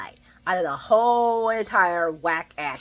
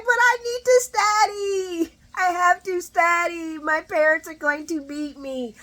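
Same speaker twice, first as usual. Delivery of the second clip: much higher, slow pleading whine; whiny Adam; 9 semitones higher than usual. Retold or imitated. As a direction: beg like a scared student. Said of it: "no, he's not acting like that".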